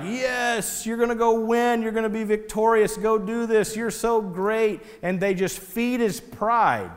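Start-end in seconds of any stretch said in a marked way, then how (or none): 0.0-4.8 high, gushing yes-men flattery. Acted. Prophets feeding the king's pride, gushing yes men. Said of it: "they're all a bunch of yes men".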